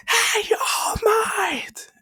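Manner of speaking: high, loud, long drawn-out yell; desperate shout. Retold or imitated. Izuku shouts to All Might across the fight. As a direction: call across the battlefield. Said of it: "Izuku's like".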